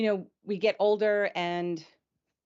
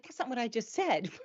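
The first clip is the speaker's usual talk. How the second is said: high, light imitation of an objection; mock protest; 5 semitones higher, 4 dB quieter than usual. Person hearing their words repeated back, protesting in mock indignation. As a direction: play-act someone disputing their own words.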